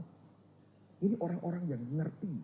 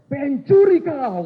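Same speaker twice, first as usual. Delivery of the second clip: slow, loud, much higher yell of thief; shouted accusation; 11 semitones higher, 16 dB louder, much slower than usual. Retold or imitated. Batak preacher yells at non-tithers, slow furious shout. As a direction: bellow the accusation at the standing congregation. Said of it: "Langsung dia teriak".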